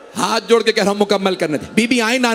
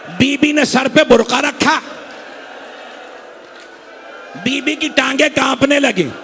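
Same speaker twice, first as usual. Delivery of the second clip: building sermon; 4 semitones higher than usual. Acted. Preacher driving mourners toward the climax, building to a shout. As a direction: climb toward the climax line by line.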